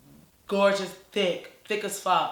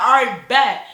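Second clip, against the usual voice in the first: loud bet; 4 semitones higher, 11 dB louder than usual. Retold or imitated. Accepting the challenge, hyped.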